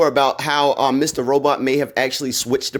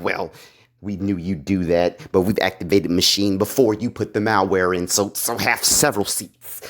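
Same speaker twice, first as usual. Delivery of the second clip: lower, smug retort; 9 semitones lower than usual. Retold or imitated. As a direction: shut him down with cool confidence.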